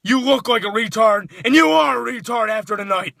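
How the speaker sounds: usual speaking voice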